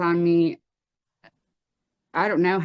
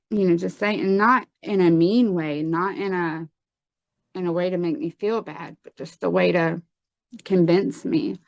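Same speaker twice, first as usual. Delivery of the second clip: usual speaking voice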